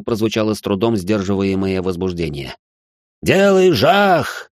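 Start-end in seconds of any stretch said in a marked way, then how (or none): 3.2-4.5 drawn-out wild yell. A narrator's reading. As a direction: bellow it out slowly. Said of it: "завопил Херля".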